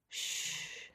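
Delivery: whispered shush, very soft. Acted. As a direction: hush her gently under your breath. Soothing her lover.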